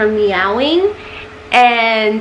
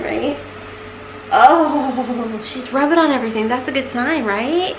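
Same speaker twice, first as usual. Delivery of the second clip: ordinary talk, nothing marked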